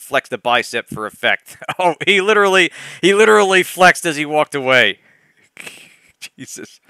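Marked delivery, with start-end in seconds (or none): 5.7-6.8 whispered. Real, not acted. Whispered after laughing.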